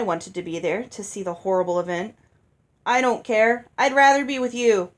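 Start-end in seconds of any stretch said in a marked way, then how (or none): 2.9-4.9 put-on loud, eager childish question; a pushy child nagging. A narrator's reading. Olive knocking Ella's arm, loud and childish.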